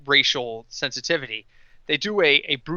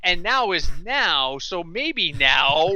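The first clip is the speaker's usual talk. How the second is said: drawn-out, slow comic backtracking; sheepish backpedal; slower than usual. Acted. Team owner taking back a vow, comic backpedal. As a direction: stretch the key words as you wriggle out of it.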